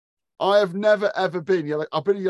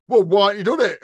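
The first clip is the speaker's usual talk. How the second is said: angry boss; 2 semitones higher, 7 dB louder than usual. Retold or imitated. Acted boss row on site.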